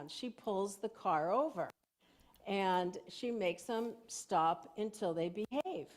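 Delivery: normal talking voice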